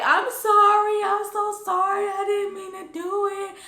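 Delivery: hammed-up bawling apology; mock crying. Student begging the dean's forgiveness, exaggerated crying. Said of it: "I started boo-hooing crying".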